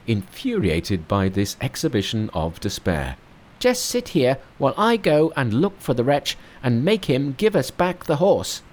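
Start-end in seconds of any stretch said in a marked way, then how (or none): 3.6-8.6 high, bright-pitched monkey king. Acted. Narrator voicing Monkey to his master, high monkey voice. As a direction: play the cocky monkey hero up high.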